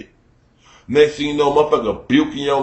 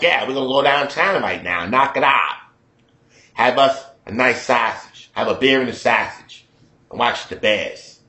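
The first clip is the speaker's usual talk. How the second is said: imitated working-guy Chicago accent; 3 semitones lower than usual.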